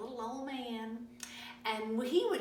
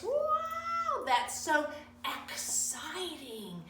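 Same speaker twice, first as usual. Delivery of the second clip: mock wow; 5 semitones higher, much slower than usual. Teacher hyping the last letter.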